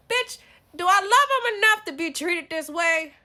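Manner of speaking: high, indignant shout; loud self-confrontation. Acted. Asking herself if she loves him enough, loud and indignant. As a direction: yell it at yourself in disbelief.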